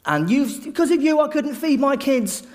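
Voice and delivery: wronged villager lashing out at the tax collector; angry accusation. Retold or imitated. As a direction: blame him for the hungry kids. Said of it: "hurling abuse at him".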